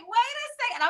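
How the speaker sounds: high-pitched comic protest; mock alarm. Retold alarm at sweaty preacher.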